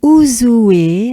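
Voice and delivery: Ouzoue linked and rising at the end; unfinished rising tune. Shown as a demonstration. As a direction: lift the tail of Ouzoue. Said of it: "I'm gonna say it like this".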